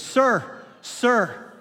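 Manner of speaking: sharp high call of sir; a stranger calling to a father scolding his son, high and loud. Retold or imitated. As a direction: call out to get his attention. Lady calls out to him.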